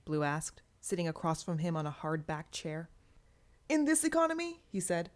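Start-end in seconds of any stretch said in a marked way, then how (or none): 3.7-4.6 high, bright boastful lift; showing off; amused boast. Acted. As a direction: brag with a grin in the voice. Cathect laughing, boasting.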